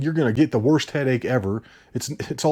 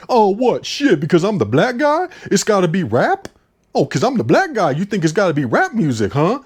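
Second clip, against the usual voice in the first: higher, louder mock indignation; fake offended; 6 semitones higher, 4 dB louder than usual. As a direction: act out a put-on outraged challenge. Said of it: "Starts getting all offended, fake offended".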